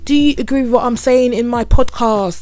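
stretched-out, upbeat closing plug; podcast sign-off. Real, not acted. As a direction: sing out your sign-off to listeners. Podcast host signing off to listeners.

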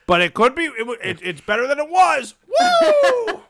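building to a loud whoop; sarcastic celebration with a cackle; mock cheer. Sarcastic cheer after self-pity.

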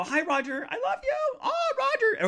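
high-pitched swooning squeal; playful high gushing; gushing mock adoration. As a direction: coo it way up high like a smitten fan. Mock-swooning greeting to Roger.

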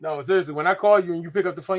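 ordinary talk

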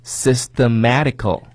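slow, syllable by syllable; slow motion. Shown as a demonstration. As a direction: read it slowly for learners. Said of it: "我再给大家慢速地来读一下哈". (慢速朗读)

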